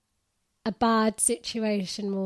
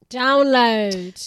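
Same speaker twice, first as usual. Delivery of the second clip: louder, punched "Download"; emphatic call; 9 dB louder than usual. Real, not acted. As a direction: call out the last item. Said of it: "calling out outro list".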